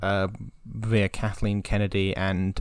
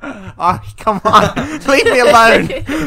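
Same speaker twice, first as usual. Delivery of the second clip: loud, high, exasperated through laughter; laughing protest; 13 semitones higher, 11 dB louder, much slower than usual. Real, not acted. Host teased by co-hosts over his claim, laughing protest. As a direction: protest loudly while cracking up.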